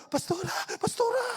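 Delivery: high, hushed excited call. Retold or imitated. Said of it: "excited ako". (tuwang-tuwang pagtawag)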